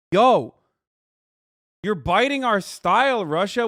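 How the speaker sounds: loud jokey yell at Russia; mock call-out. Streamer taunting Russia with a loud mock call-out. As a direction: shout it across like a taunt.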